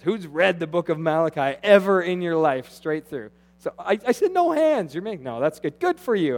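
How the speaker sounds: usual speaking voice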